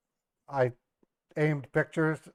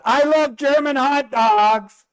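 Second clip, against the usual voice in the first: much higher, louder, slow put-on voice; put-on teasing voice; 12 semitones higher, 8 dB louder, slower than usual. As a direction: needle the mother-in-law with a silly voice. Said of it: "I said".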